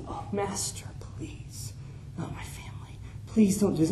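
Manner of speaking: desperate slave begging in a whisper; pleading whisper. Acted. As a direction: whisper it, begging for his family. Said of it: "the slave pleads".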